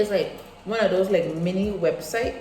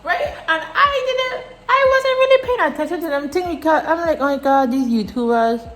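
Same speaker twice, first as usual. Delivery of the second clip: loud excited rant; 4 semitones higher, 9 dB louder than usual. Real, not acted. Excited rant about YouTubers.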